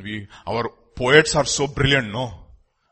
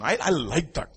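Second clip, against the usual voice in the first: ordinary talk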